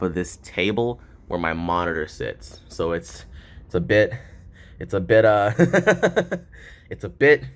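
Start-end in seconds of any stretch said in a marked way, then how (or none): 5.6-6.4 short burst of laughter; embarrassed laugh. Real, not acted. Laughing at the janky setup.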